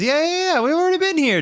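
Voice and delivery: very high squeaky outburst; exasperated. Real, not acted. Reader realising this part is a repeat, exasperated high squeal. Breaks off reading, exasperated at repost.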